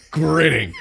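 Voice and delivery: usual speaking voice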